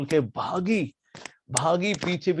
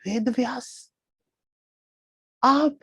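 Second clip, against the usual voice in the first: high, startled gasp of a question; shocked surprise; 9 semitones higher, slower than usual. Acted. Jaimini shocked to find Vyasa in his arms. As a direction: jump up in pitch, caught out.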